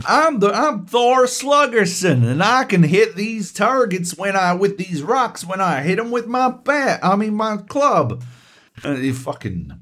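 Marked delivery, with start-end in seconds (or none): 0.1-8.2 high, hammed-up boastful ballplayer; mock a miscast baseball star in a game; Thor Sluggerson. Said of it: "not even attempting to do a fucking a-, accent".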